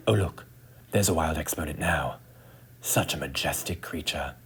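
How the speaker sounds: hushed wildlife narrator, awed; nature documentary whisper. Acted. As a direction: whisper in quiet awe. Hushed spotting of exponent.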